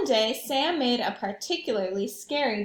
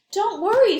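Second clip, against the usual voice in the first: kindly monster voice; 8 semitones higher, 10 dB louder than usual. Acted. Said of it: "said Leonardo to Sam".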